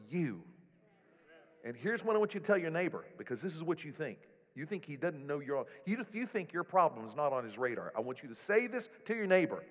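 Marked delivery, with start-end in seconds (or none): none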